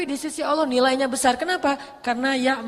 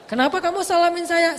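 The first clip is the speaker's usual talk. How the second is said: indignant wife; 6 semitones higher, 4 dB louder than usual. Acted. Wife confronts greeting husband.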